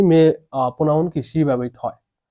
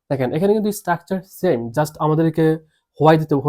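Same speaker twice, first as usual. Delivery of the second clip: ordinary talk, nothing marked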